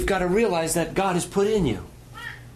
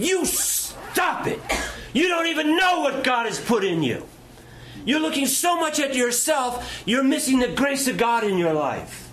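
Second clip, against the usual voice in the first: stern rebuke; 10 semitones higher than usual. Retold or imitated. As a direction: scold him hard while shaking him. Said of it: "She said".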